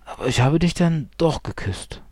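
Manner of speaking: gentle but persistent objection; insistent. A narrator's reading. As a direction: insist on it, pitch lifted. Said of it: "beharrte er".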